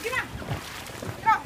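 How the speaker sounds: usual speaking voice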